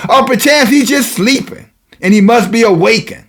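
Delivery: high, taunting prophet's challenge; mock their god with lifted, teasing questions. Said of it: "He's mocking them".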